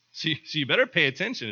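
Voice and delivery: normal talking voice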